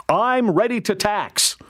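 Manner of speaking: high, drawn-out mock quote; Olivia Chow. Columnist quoting a mayoral candidate's tax pledge, mock quote. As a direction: quote the candidate slowly, pitched well up. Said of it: "She says so".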